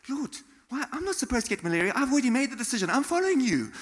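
indignant protest. Retold or imitated. Acting out his own protest to God.

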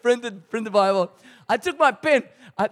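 usual speaking voice

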